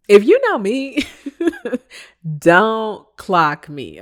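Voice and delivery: "if you know me" high and loud, then "don't clock me" drawn out very slow; a loud, slow warning not to cross her; sassy warning. Real, not acted. Warning coworker not to watch.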